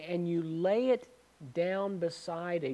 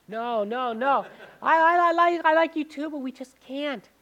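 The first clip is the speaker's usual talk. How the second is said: much higher and louder, frantic refusal; shrieking girlfriend; 9 semitones higher, 9 dB louder than usual. Retold or imitated. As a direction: yelp it out like the scared girl. Girl refusing again, high.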